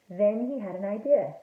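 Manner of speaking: ordinary delivery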